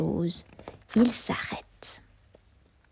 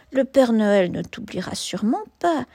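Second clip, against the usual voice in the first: plain reading voice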